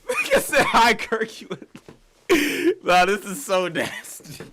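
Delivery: high, loud, laughing disbelief; cracking up. Real, not acted. Streamer reacting to a cringe chat message, cracking up. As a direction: squeal it through helpless laughter.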